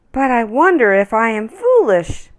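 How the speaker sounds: put-on a child doubting herself; doubtful little girl. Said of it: "she said".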